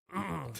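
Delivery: short, pointed throat clearing; composing-yourself throat clear; imitated throat clear. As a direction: clear the throat as if reining in temper. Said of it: "He did the".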